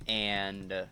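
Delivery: normal talking voice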